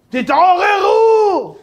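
high, drawn-out, loud wake-up cry; frantic philosopher yelling wake up; alarmed shout. Retold or imitated. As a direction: yell it like a panicked prophet. Philosopher warns world in danger.

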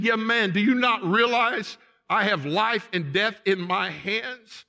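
high, loud imitated rebuke; acted royal fury. King reminding the deserter he holds his life, loud acted anger. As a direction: thunder it as the judging king.

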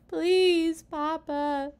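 put-on high, drawn-out child's plea; begging. A narrator's reading. Children begging their father for a tree, pleading. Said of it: "begged the children".

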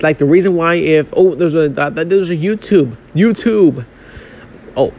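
usual speaking voice

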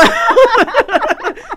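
high, loud burst of laughter. Real, not acted. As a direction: laugh at your own point. Laughs after accent joke.

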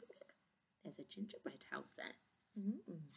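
hushed aside, barely breathed; whisper. Real, not acted. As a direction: point it out in a secret whisper. Reader's whispered aside to listener.

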